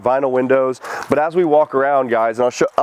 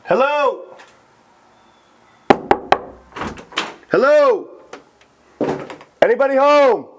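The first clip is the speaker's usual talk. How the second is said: calling out; 14 semitones higher than usual. Real, not acted. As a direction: call out to anyone inside. Announcing self in empty house.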